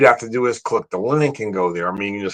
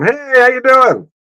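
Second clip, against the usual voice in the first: mock yell down; 13 semitones higher than usual. Hotel guest greeting a worker down below, mock yelling. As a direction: imitate calling to someone below. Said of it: "I would yell down at 'em".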